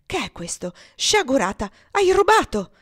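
outraged shout, rising in pitch and volume; catching a thief red-handed; angry accusation. Acted. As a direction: build to a loud, high cry of accusation. Catches the girl stealing.

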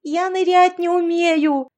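high, slow terrified yell; panicked wail. A narrator's reading. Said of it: "завопила Яшка".

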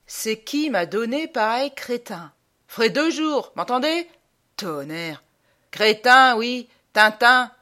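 high, loud scolding sergeant; blustering army sergeant; old sergeant. Acted. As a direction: berate the recruit in short outbursts. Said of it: "Un vieux sergent me dit".

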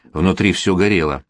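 usual speaking voice

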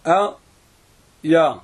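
"آية" split in two with a long pause; syllable by syllable. Shown as a demonstration. Teacher splits word for dictation.

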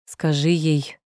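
usual speaking voice

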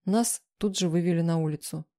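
ordinary delivery, nothing marked

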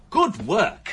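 emphatic praise. Acted. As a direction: repeat it with real enthusiasm. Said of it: "I never thought I'd say this".

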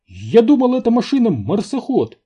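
very high, loud squeak; squeaky protest. A narrator's reading. Энди thought it a Mars rover, squeaking indignantly. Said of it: "пискнул Энди".

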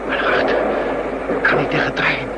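gasping, breathless pleas for air, partly voiceless; a man faking a heart attack, short of breath; faked heart attack. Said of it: "een gesimuleerde hartaanval".

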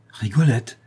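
a light cry of recognition on Rigolette; surprised exclamation. A narrator's reading. Lorraine hears a friend's name and cries out surprised. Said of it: "s'écria la Lorraine".

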